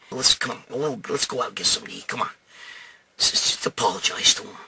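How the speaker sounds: low, soft peacemaking voice; quiet coaxing. Retold or imitated. Paul urges his friend to apologize, quietly coaxing. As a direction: drop it right down and gently urge. Paul coaxes him to make up.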